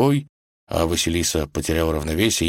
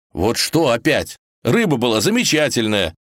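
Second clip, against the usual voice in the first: raised, protesting voice; exasperated; 6 semitones higher, 4 dB louder than usual. Acted. Ivan defends his fish against Vasilisa's complaint, exasperated. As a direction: push back, wounded and insistent.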